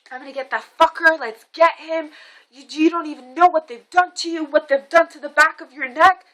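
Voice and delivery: high, loud and fast worked-up threat; imitating her frantic anger; freaking out. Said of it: "freaking out".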